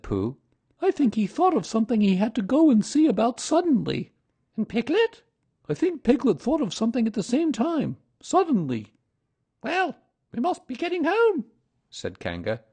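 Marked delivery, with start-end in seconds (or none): 0.8-8.9 high, loud bear character; high-pitched explaining bear; Pooh's voice. A narrator's reading. Pooh voiced in the story. 9.6-11.4 very high mother kangaroo; high-pitched motherly voice; Kanga's voice. A narrator's reading.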